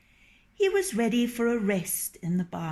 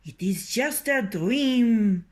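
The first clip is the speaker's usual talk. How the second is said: scornful; 4 semitones higher, 6 dB louder than usual. Acted. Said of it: "shook his head scornfully".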